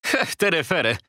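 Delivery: a high, dismissive little scoff; mocking scoff. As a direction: brush him off with a sneer. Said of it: "mówi Szprot i kręci palcem w powietrzu". (lekceważące prychnięcie)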